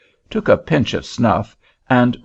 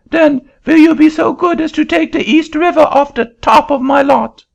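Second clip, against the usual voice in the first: put-on very high, loud French-accented protest; an indignant Frenchman in a shrill-high French accent; angry French accent; 6 dB louder than usual. Frenchman refusing the auctioneer's seat, high loud French accent.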